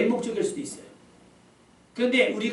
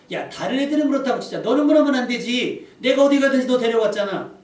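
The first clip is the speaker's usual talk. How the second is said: louder, higher reproachful outburst; hurt angry rebuke; 5 semitones higher, 7 dB louder than usual. Pretend. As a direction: scold a betraying friend. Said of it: "서운함을 막 얘기하신 것이 아니에요".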